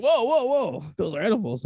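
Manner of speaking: high, urgent whoa whoa; a friend stopping him in alarm; alarmed warning. Retold or imitated. Buddy warning him the candy is edibles, high and urgent.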